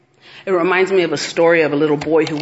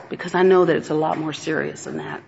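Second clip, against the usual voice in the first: usual speaking voice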